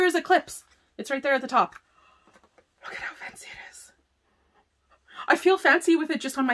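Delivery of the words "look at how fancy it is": whispered, hushed admiration; admiring whisper. Real, not acted. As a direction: whisper it like a secret treasure. Admiring swatch on her hand.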